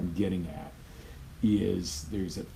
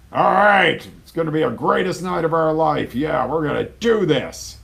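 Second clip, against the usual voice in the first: much higher and louder, pumped-up cheer; mock overhype; 9 semitones higher, 12 dB louder than usual. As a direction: overdo the enthusiasm for comedy. Mocks overhyped husband on date.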